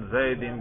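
"زیدن" ending in -in; "زیدن" with an i before the final n; genitive ending. Shown as a demonstration. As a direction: say "زیدن" as zaidin. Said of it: "تینوں طرح اعراب اس پر آتے ہیں".

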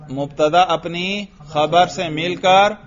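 ordinary talk, nothing marked

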